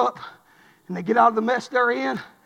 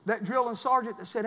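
ordinary delivery

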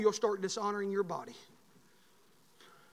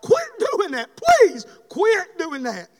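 loud begging plea; urgent preacher's plea; pleading shout; 8 semitones higher, 10 dB louder, much slower than usual. Acted. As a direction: beg them to stop. Pleading with congregation.

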